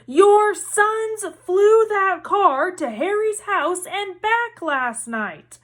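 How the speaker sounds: high, slow, furious shout; shouting mother. Acted. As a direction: hammer each word at him. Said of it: "shouted Missus Weasley".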